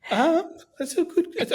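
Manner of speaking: high, slow laugh into the answer. Real, not acted. Laughs at the question.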